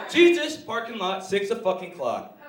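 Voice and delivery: loud, slow call-out to a brawl; fighting challenge. Acted. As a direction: punch out each part like a challenge. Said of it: "make it sound like we wanna fight".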